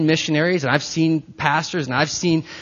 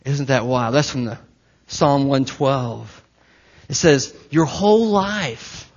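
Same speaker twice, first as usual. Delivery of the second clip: ordinary talk, nothing marked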